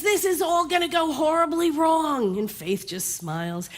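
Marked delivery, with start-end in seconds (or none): 0.0-2.4 higher voice, anxious fretting; a worrier predicting doom; fretful Fear. Acted. Fear frets to the bartender, higher and anxious. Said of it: "Fear gets water and says".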